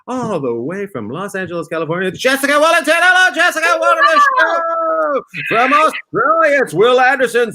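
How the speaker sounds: high, loud show-host intro; announcer introducing guests. Acted. Host bringing on tonight's comedians, loud announcer call. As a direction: belt out the names like a ring announcer.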